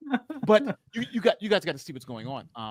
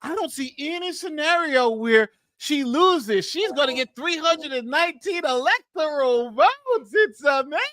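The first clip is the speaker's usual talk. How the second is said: mock pundit; 11 semitones higher, 4 dB louder, slower than usual. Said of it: "She's like".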